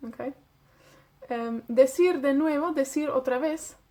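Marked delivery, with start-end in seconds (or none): none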